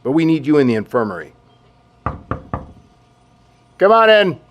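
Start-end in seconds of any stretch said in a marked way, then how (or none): 3.8-4.4 raised voice to let them in; calling out. Acted. General calling a visitor in, loudly. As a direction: call loudly through the door.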